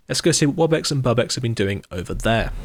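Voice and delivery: usual speaking voice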